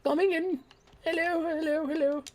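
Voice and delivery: high, prim British toff greetings; Monopoly man voice. Retold or imitated. Vlogger imitating himself as the posh Monopoly man. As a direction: tip your top hat and chirp hello. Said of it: "like the fucking Monopoly man".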